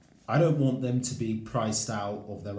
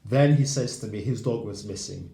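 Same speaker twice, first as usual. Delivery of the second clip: ordinary talk, nothing marked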